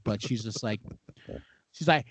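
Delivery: usual speaking voice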